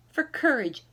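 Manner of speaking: ordinary delivery, nothing marked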